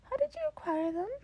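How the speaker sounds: bright eager offer from a cheerful mentor; Nezu's voice. Acted. Nezu voiced by reader.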